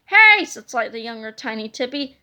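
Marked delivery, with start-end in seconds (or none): 0.1-0.5 high, loud protesting hey; indignant hey. Acted. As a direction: call out in protest. Younger twin objects.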